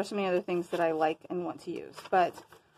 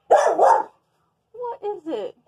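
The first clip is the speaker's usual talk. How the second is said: high, loud, slow call; drawn-out call to pet; 9 semitones higher, 9 dB louder, much slower than usual. Real, not acted. Calling to her pet.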